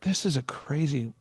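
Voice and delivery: soft, spaced-out inner thought; retold moment of quiet disbelief; hushed awe. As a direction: say it quietly to yourself, amazed. Retold awed thought to himself.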